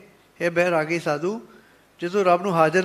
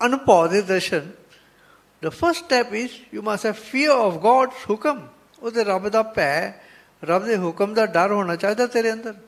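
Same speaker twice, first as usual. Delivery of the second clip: normal talking voice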